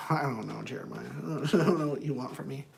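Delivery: low, quiet acted grumble; reluctant client. Anger management client answering the counselor, low and reluctant. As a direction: play a client who has no answer to give.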